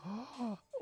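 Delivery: sharp, high intake of breath; quick high gasp of disbelief; shocked gasp. Real, not acted. Shocked at the video.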